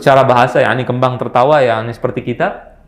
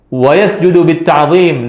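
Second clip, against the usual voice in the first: slow, higher, louder chanted verse; slow recitation; 4 semitones higher, 5 dB louder than usual. Shown as a demonstration. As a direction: draw out each word of the verse. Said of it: "teacher recites verse line again".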